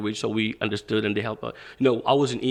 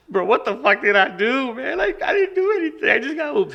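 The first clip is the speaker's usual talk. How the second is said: high-pitched retold panic, rising sharply; panicked protest; 11 semitones higher than usual. Scared kid protesting to the gang leader, panicked. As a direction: plead your innocence, voice climbing high.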